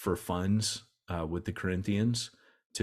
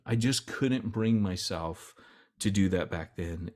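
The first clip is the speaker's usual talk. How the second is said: ordinary talk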